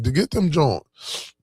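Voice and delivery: slow, drawn-out put-on Philly accent. Recalling his late friend's hustle, in a slow Philly accent. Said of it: "In my Philly voice".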